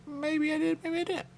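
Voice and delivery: very high singsong tease; playful falsetto taunt; coy teasing. Pretend. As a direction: toy with them, high and sly. Teasing about a past kill.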